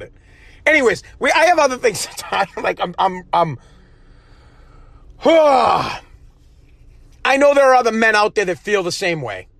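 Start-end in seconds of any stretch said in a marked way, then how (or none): 5.2-6.0 long weary sigh; heavy exasperated sigh. Real, not acted. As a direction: let out a loud fed-up sigh. Aggravated sigh.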